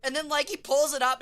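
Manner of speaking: ordinary delivery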